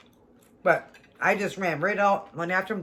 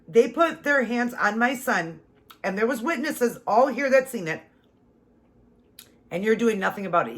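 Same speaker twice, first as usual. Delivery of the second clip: retold angry protest; 5 semitones higher, 3 dB louder than usual. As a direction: insist hard, as if still furious at the cops. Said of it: "I was so mad".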